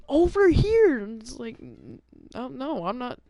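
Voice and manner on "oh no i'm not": usual speaking voice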